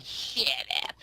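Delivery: whispery goblin voice. Acted. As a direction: hiss it like a goblin. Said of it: "in the corner just saying".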